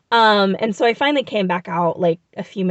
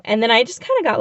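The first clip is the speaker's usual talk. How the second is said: ordinary talk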